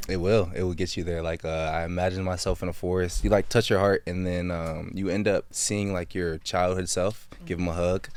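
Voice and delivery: soft, low, subdued recollection; quiet, emotional. Real, not acted. Guest recalls meeting his childhood self in meditation, quiet and emotional. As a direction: speak low and softly, turned inward.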